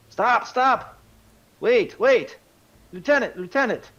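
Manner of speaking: high, loud shouts to halt someone; shouted plea from down the ramp; urgent calling out. Acted. Voices calling after departing party.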